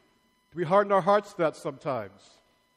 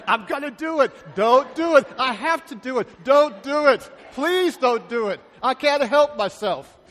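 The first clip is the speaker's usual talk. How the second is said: loud, higher, hammed-up tug-of-war between temptation and God's warning; acted back-and-forth; 5 semitones higher, 8 dB louder than usual. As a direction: play both sides of the struggle, louder on each "Don't". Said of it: "And we say".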